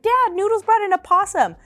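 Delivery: high, urgent telling; high alarmed report. Retold or imitated. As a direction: blurt it out to Dad in panic. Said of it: "I was like".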